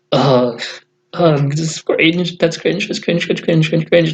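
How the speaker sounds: mock-cringing inner reaction; cringing disgust. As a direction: groan it, then repeat it like a recoiling reflex. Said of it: "he makes me feel cringe".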